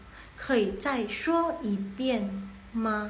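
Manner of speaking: slow, spaced-out Mandarin question; slow model reading. Shown as a demonstration. Teacher shows how to ask for a repeat, slow model reading. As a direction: give each syllable its own beat. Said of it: "ini Mandarinnya".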